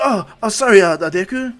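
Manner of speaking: loud, high burst of apology; startled apology. Acted. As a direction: blurt it out, caught off guard. Group reacts to the date news.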